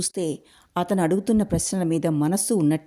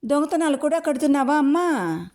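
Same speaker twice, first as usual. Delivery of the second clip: high, sarcastic retort; woman answering a child's accusation, indignant; indignant neighbour woman; 9 semitones higher than usual. A narrator's reading. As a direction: toss the theft charge back in scorn.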